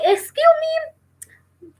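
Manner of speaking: high, slow, drawn-out excuse me; squeaky outraged disbelief; mock indignant. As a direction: sound mock offended and incredulous. Mock indignant reply to teacher.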